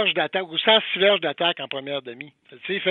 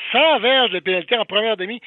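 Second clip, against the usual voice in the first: stressed, louder, higher retelling; emphatic; 4 semitones higher, 6 dB louder than usual. Retold or imitated. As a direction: drive home the number. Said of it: "j'ai dit".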